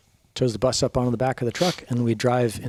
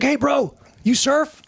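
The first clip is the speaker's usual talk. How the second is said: high-pitched surfer-bro voice for Kurt; imitating Kurt; 13 semitones higher, 4 dB louder, slower than usual. Narrator imitating the stranger who asks if he surfs, high bro voice. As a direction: pitch way up, eager stoner bro.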